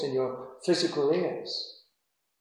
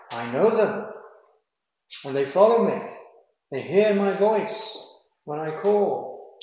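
louder, rising proclamation of the verse; emphatic scripture reading; 3 semitones higher, 5 dB louder than usual. Shown as a demonstration. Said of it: "reading Jesus' words emphatically".

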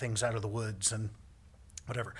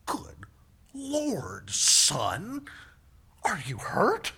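drawn-out exclamation rising high and loud; imitating an astonished dad; shocked father; 5 semitones higher, 6 dB louder, much slower than usual. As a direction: react in stunned alarm.

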